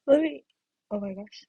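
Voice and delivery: yawning mid-sentence. Real, not acted. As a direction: talk through a big yawn. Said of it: "I'm yawning".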